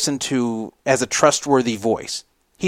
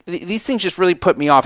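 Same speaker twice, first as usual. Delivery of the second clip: ordinary talk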